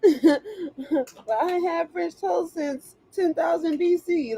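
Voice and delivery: high, slow, laughing through the words; real giggly disbelief. Real, not acted. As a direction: crack up while you say it. Laughing at spirits' appetite.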